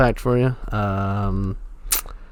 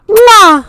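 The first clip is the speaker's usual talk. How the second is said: loud, high smacking kiss; between kisses; 14 dB louder than usual. Acted. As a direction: blow a big loud kiss. Blowing kisses to say goodbye.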